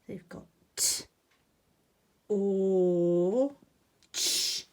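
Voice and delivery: "T", "O", "Ch" said one at a time; spelling out. Shown as a demonstration. Teacher spelling "torch" for children. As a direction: spell "torch" slowly by its sounds. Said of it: "how to write torch".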